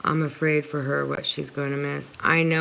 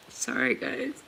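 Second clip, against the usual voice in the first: fighting back tears while speaking; real emotion, the voice climbs and strains; getting emotional; 5 semitones higher than usual. Real, not acted. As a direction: voice rising high as tears break through. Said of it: "Sorry, guys, for getting emotional".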